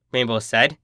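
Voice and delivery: ordinary delivery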